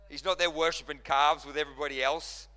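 ordinary talk

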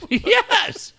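high, drawn-out exclamation; laughing, high-pitched yes; emphatic comic yes. Real, not acted. Repeats the comic admission amid laughter.

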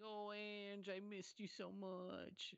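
high, slow, syrupy longing; mock tearful reunion. Mocking the husband reunited with his wife, high gushing voice. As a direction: gush like a corny movie husband.

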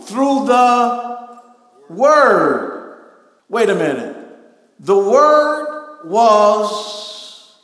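loud preacher stretching the key words; full-force preaching. Real, not acted. Preacher explaining creation through the Word to congregation, full-force preaching. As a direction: proclaim it with mounting conviction, loudly.

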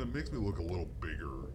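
usual speaking voice

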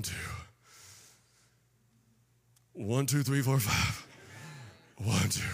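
low, soft muttered count; counting under breath. Acted. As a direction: count quietly to yourself. Acts out Philip counting under breath.

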